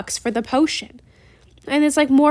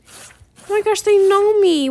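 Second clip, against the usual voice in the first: high, loud delighted gasp at being recognized; thrilled, like meeting a fan; real excitement at being known; excited squeal; 8 semitones higher, 6 dB louder, slower than usual. Real, not acted. Fan recognizes her in game.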